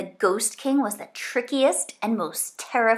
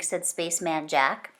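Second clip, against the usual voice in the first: plain reading voice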